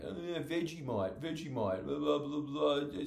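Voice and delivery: mock childlike chatter; childlike friend. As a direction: prattle aimlessly like a kid. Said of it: "he does have a childlike mind".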